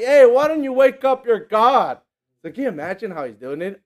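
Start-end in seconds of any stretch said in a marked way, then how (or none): 0.0-2.0 loud, higher, slow taunting; mocking jeer. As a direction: drawl the taunt to provoke them. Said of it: "He was like".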